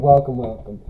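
normal talking voice

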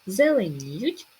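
"Зеленіють" slow, with stretched ні; "Зеленіють", drawn out on the stressed ні. Shown as a demonstration. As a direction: lengthen the і in "Зеленіють". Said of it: "наголос на і".

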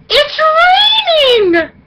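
high, slow, stretched-out shout; ecstatic reveal. Real, not acted. Vlogger revealing that it's finally raining, drawn-out excited shout. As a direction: sing out the big reveal.

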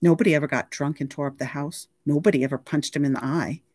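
ordinary delivery

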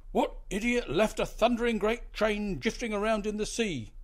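high loud furious growl; angry growl. A narrator's reading. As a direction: snarl it out in disgust. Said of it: "the captain growled".

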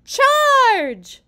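very high, very loud long shout; hollered battle cry. A narrator's reading. President leading a pillow fight, hollering. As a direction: bellow it like leading a charge. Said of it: "the president hollered".

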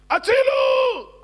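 long, high, loud yell for rescue; drawn-out desperate holler; shouted cry for help. A narrator's reading. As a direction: scream it out, stretching the last vowel. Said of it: "צועק".

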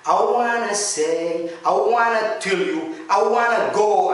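"I wanna" smooth, "want to" reduced to "wanna"; wanna reduction. Shown as a demonstration. ("wanna" بدل "want to")

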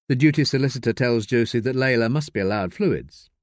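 ordinary delivery

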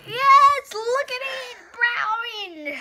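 high, loud, thrilled cry of triumph; excited shout. Real, not acted. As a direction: shout it out, delighted you were right. Guessed the flavour right.

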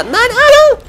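shrieking in panic; being chased. Real, not acted. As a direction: shriek as it lunges at you. Zombie attack during gameplay.

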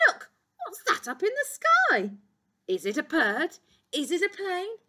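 high, loud, wondering calls; excited cry. A narrator's reading. Keeper spots something up in the sky, excited cry. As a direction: call out in amazement at the sky. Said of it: "cried Mister Keeper".

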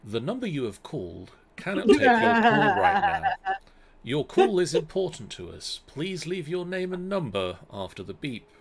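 automated voicemail recording; phone menu voice. Acted. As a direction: read it like a robotic answering machine. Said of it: "you get the magical response along the lines of".

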